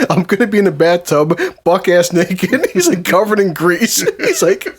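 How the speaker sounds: high-pitched telling broken up by laughter; cracking up. Real, not acted. Friend retells Al's death wish to the group, cracking up. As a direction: struggle to get the joke out through laughs.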